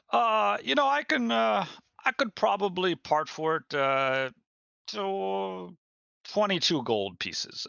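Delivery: high, eager merchant voice. Acted. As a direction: pitch it up like a sly trader.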